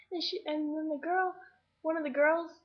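usual speaking voice

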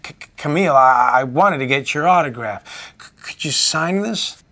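nervous fan stammering for an autograph. Acted. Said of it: "I stammered".

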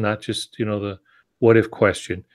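ordinary delivery

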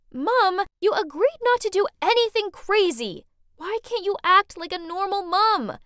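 high, exasperated protest; arguing daughter. A narrator's reading. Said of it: "we started arguing".

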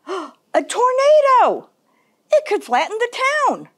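high-pitched storybook alarm. Acted. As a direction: cry out at the danger in the sky. Holler spots a tornado, alarmed.

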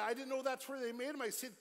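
ordinary talk

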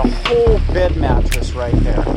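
usual speaking voice

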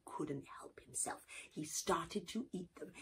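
whispered narration. A narrator's reading. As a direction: whisper it like a secret. Secret greedy eating.

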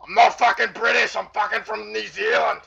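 high, loud protest; indignant yell. Real, not acted. New Zealander to players calling him British, indignant yell. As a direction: correct them at the top of your pitch.

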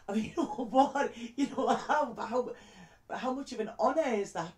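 overwhelmed laughter at being asked; laughing. Real, not acted. A friend asks her to pick a song, laughing.

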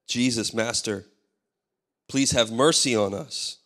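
normal talking voice

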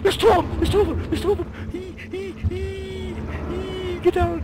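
high frantic yelling; panicked shouting. Real, not acted. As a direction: yell in rising panic. Two monsters attack.

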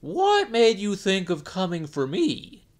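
monkey questioning the jellyfish; wary monkey. Acted. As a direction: probe with sudden doubt. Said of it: "So he said to the jellyfish".

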